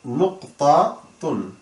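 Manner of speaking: slow, separated syllables. Shown as a demonstration. Teacher introducing the Arabic for full stop, slowly syllable by syllable. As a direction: say "নুক্তাতুন" piece by piece, pausing between.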